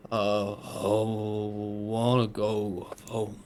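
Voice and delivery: weak, slow dying voice; dying man. Acted. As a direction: fade out like a dying soldier. Dying character's last words.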